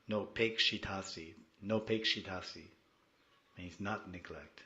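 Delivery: ordinary delivery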